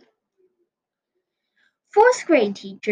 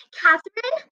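high searching call; 11 semitones higher than usual. Acted. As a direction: call her name across the room. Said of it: "she called".

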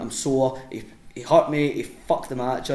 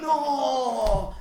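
high, drawn-out mock cry of horror; long dismayed wail; 12 semitones higher than usual. Wrestler hearing partner offer a rematch, wailing in mock horror. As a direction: stretch it out high, trying to stop him.

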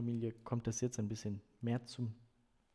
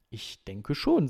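drawn-out, louder tentative answer; slow, hesitant; 2 semitones higher, 11 dB louder, slower than usual. A narrator's reading. Coach answers the parents' question, a little slowly. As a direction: answer with a touch of deliberation. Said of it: "sagte er langsam".

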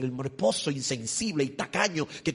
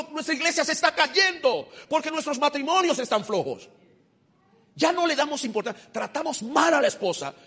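high, loud, urgent sermon cry; preaching at full force; 9 semitones higher, 5 dB louder than usual. Acted. Preacher exhorting husbands in church, loud fervent preaching.

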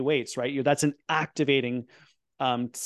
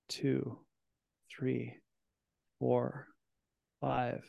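guided breathing count; slower than usual. Acted. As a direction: ease the listeners through each count, unhurried. Leads a breathing exercise.

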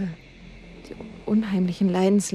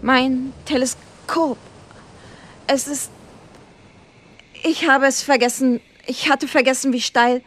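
out of breath; 5 semitones higher, 4 dB louder than usual. Acted. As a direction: wheeze it out, barely standing. Crowe collapsing after steep climb.

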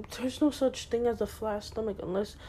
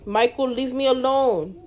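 louder, irritated call to the little brother; annoyed yell; 3 semitones higher, 7 dB louder than usual. Real, not acted. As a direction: shoo the brother out of the room, louder. Little brother interrupts the video.

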